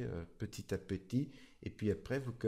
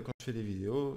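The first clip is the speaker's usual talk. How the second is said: ordinary talk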